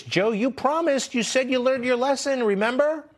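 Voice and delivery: high, pleading scold aimed at Joe; mock scolding plea. Host addressing Joe about touching people, high mock-reproachful plea.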